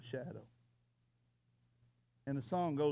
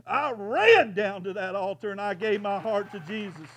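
rising, louder, higher preacher's cry climbing to a shout; preaching at full force; 8 semitones higher, 8 dB louder than usual. Acted. Preacher pleads to the congregation, building to a shout. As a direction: build the sermon to an impassioned climax.

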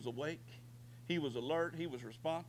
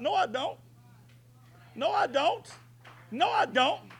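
high, loud defiant refusal, repeated; shouted denial; 13 semitones higher, 6 dB louder than usual. Pretend. Believer shouting down the cancer report, defiant loud cry. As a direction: throw back the diagnosis, loud and high. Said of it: "You gotta shout it out".

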